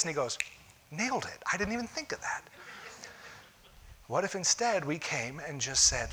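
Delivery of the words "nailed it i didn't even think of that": higher, softer sarcastic praise; a pretend God feigning amazement; hammed-up sarcastic wonder; mock impressed God. God mockingly praising suggestion.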